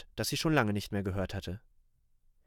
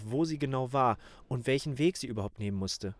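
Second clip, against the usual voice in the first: ordinary delivery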